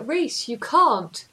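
put-on slow drawn-out South African accent. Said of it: "in a South African accent".

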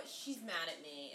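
ordinary talk

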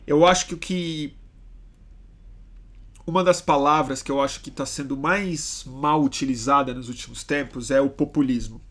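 usual speaking voice

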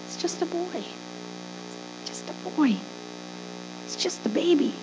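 very soft, throwaway belittling; hushed, dismissive family voice; dismissive aside. Acted. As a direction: wave the boy off under your breath. Voices family dismissing young David.

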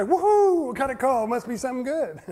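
high, loud, laughing woohoo; mock delight. Pretending to greet a 3 AM call, mock delighted. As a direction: ham up a cheerful answer. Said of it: "answer and say".

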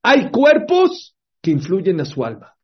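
más fuerte, lento y más agudo; recálcalo para que presten atención; énfasis. Real, not acted. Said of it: "pongan atención". (sermón enfático y lento, fuerte)

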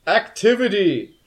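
high, slow booming demon cat; slow booming creature voice. Acted. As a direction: boom it out like an otherworldly cat. Cat offers to take an oath.

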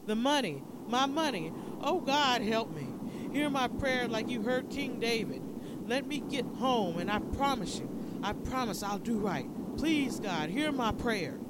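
loud, high cries of anguish; desperate pleading prayer. A narrator's reading. Dying preacher bargains with God in the desert, desperate pleading prayer. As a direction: pray at full voice, begging to be heard.

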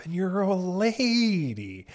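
high, slow, sugary; mock sweet voice. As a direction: drawl out lady sweetly. Addressing Hillary Clinton.

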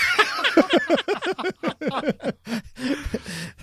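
loud burst of laughter. Real, not acted. Big laugh.

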